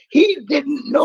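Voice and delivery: slow, high, loud plea; emotional shout. Real, not acted. Telling the story of Ray's son, slow and high with feeling. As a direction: push it out, heartfelt and slow.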